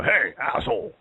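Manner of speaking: mock angry outburst. Diner acting out snapping in a restaurant, mock angry outburst. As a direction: play suddenly pissed off, hamming it up. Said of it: "this really nice little voice, and then someone pisses me off".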